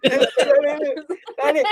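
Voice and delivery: high, loud mock dismissal; laughing imitation of a rejection; imitated blunt verdict. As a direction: echo the partner's put-down through laughter. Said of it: "the way she says it".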